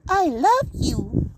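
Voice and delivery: very high, slow squeaky toy voice; a stuffed cat's recorded squeak; tiny plush doll speaking, drawn out and high. Acted. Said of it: "and the cat says".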